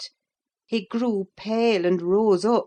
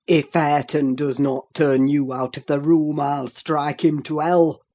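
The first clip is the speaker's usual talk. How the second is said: low, loud furious threat; thundering; 5 semitones lower, 6 dB louder than usual. A narrator's reading. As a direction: roar the threat. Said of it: "thundered Heathcliff".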